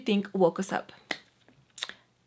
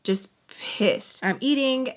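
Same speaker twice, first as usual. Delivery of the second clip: usual speaking voice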